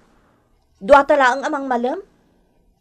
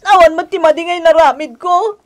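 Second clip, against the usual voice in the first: crying; 7 semitones higher, 3 dB louder than usual. Acted. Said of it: "Gusto day ta na panagsangit mo".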